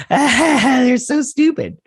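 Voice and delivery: high, loud, slow exasperated wail. Real, not acted. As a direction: stretch it out in disbelief at them. Exasperated rant about the aliens.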